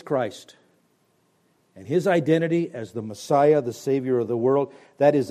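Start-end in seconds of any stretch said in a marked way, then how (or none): none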